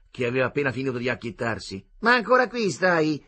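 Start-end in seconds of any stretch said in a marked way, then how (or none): 2.0-3.2 impatient Roman matron. A narrator's reading. Sor Adele scolding, impatient.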